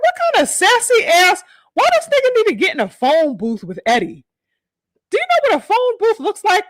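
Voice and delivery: high disbelieving rant; can't believe what he heard; incredulous. Real, not acted. Host reacts to Oscar incredulous.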